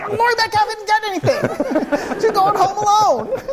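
high, loud comic exasperation; mock-exasperated yell at a worried friend; exasperated retort.

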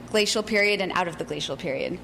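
normal talking voice

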